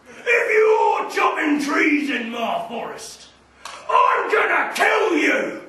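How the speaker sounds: slow, loud, roaring monster voice; a furious giant troll bellowing; troll threat. Acted.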